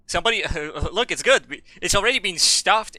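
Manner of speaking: laughing, loud and high; gleeful, can't believe the luck; excited. Real, not acted. Gamer shouting excitedly at the easy find.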